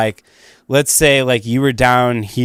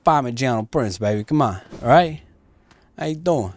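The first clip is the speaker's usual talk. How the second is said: put-on Italian-American wiseguy accent; 2 semitones lower, 4 dB quieter than usual. Shown as a demonstration. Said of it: "American Italian accent".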